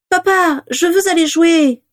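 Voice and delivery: put-on very high, drawn-out child's voice; little girl. A narrator's reading. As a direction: plead like an impatient small child. Little girl begs her father.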